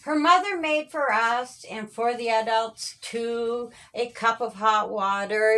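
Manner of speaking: ordinary delivery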